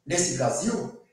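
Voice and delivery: ordinary delivery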